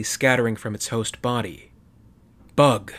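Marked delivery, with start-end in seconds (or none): 2.6-2.9 sudden loud shout; shouting at the creature. A narrator's reading. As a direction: yell the warning out. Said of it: "Glass shouted".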